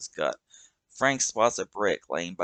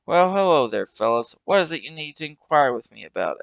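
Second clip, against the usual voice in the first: put-on higher, louder folksy old hobo; Douglas's voice; 7 semitones higher, 7 dB louder than usual. Old hobo Douglas greeting the kids, higher and louder. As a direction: greet them like a showy old drifter.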